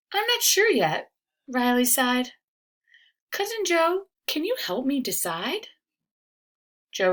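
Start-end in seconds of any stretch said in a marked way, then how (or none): 0.1-1.1 put-on high, hesitant child voice; a small boy unsure of himself. Riley telling his cousin he is unsure, high child voice. 3.3-5.7 put-on high, hesitant child voice; a small boy unsure of himself. Riley telling his cousin he is unsure, high child voice.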